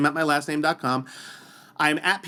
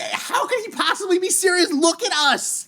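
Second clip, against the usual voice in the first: high-pitched comic disbelief; incredulous; 13 semitones higher than usual. Retold or imitated. Athlete marvelling at rival's question, high incredulity. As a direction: exclaim it as if the idea is absurd.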